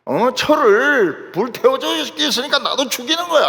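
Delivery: acted-out outraged retort; indignant Samson. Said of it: "삼손이 뭐라고 말합니까?".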